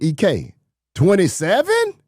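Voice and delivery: high, incredulous question; acted disbelief. Player questioning equipment manager about his number, mock disbelief.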